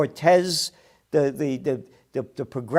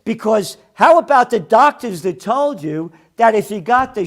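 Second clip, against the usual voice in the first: raised, louder and higher, indignant; angry rant; 6 semitones higher, 9 dB louder than usual. Real, not acted. Host tears into networks over vaccine promises, angry rant. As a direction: hammer out the accusation, outraged.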